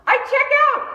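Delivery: very loud, high cry; panicked shout. Retold or imitated. Terrified rider as the ride takes off, panicked shout. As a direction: shriek it at the top of the drop. Acts out riding in terror.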